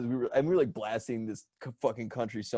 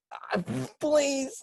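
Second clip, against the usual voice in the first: mock pleading. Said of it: "and now it's like".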